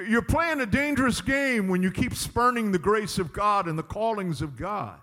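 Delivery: normal talking voice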